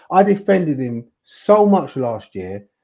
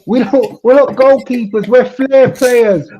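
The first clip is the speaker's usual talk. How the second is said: high, loud, drawn-out insistence; animated protest; 7 semitones higher, 6 dB louder than usual. Real, not acted. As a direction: protest it loudly, pitched right up. Comic protest about brothers in goal.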